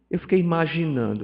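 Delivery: ordinary delivery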